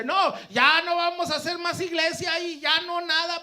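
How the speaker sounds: loud, high acted outburst; wife's angry refusal. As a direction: shout it like a fed-up spouse.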